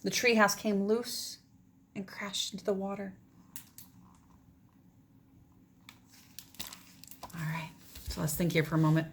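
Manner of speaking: lively read-aloud; story narration. Acted. Teacher reading a picture book to her class, expressive narration. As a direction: carry the children through the tale.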